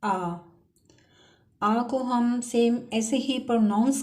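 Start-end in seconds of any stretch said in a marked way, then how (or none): 0.0-0.4 आ said as an open long a; teacher sounding out आ; German letter आ. Shown as a demonstration. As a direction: model the letter आ slowly. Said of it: "पहला हर्फ है".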